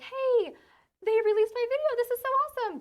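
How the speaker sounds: high, loud, bubbly excitement; retelling her thrilled pitch to husband; excited. Retold or imitated. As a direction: gush about the video, way up high. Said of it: "I was like".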